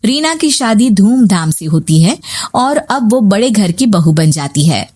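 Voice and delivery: plain reading voice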